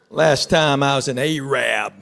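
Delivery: ordinary delivery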